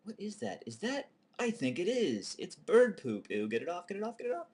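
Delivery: high, loud rising disgust panic; phobic panic. Retold or imitated. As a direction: squeal it off in growing panic. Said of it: "it'd be like".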